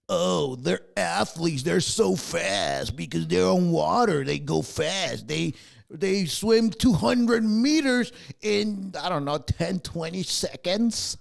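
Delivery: slow, mocking impression of awed fans; mocking swimming fans. Vlogger mocking people who admire swimmers, slow sneer. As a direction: drag it out like a gushing fan. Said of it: "those people are like".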